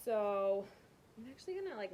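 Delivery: ordinary delivery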